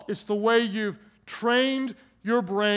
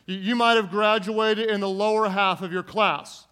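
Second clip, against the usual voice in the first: ordinary delivery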